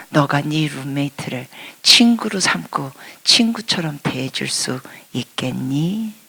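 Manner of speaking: soft, slow whispered plea; God's gentle whisper to Christy; tender whisper. Retold or imitated. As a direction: whisper it with deep kindness. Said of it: "이렇게 친절하신 분입니다".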